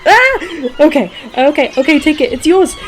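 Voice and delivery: high, loud yelps of giving in; panicked surrender. Real, not acted. Surrendering the sandwich to the seagulls, high panicked yelling. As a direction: squeal and hand it over fast.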